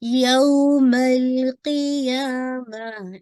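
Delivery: यौमल क़ियामा chanted slowly with the lam held; slow Quran recitation. Shown as a demonstration. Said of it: "जरा सा time देंगे".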